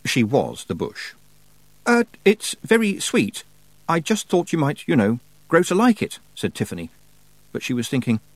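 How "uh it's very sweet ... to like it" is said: put-on much higher, halting girl's voice; hesitant young witch. Girl caught hiding, explaining her gift, hesitant. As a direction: fumble a polite excuse.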